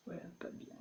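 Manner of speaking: a hushed aside to the group; whispered verdict. Real, not acted. Judging the joke, in a whisper. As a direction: whisper it under your breath.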